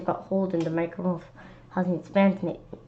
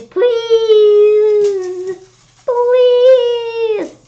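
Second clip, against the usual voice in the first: much higher, loud crying plea; desperate begging; 14 semitones higher, 9 dB louder than usual. Acted. Kid begging the monster not to hurt them, crying. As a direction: sob out please.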